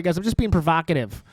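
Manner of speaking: ordinary talk, nothing marked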